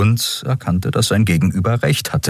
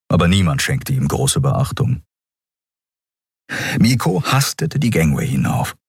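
ordinary delivery, nothing marked

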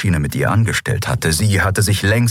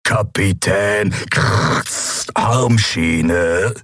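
shadow emperor; much slower than usual. Acted. Schattenkaiser fordert.

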